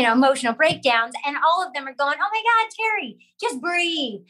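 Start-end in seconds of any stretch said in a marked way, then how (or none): 2.2-4.2 lightly acted, higher-pitched exasperated advice; imitated concerned friends. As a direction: urge a panicking friend, mildly hammed-up. Imitates friends reacting to her breakdowns.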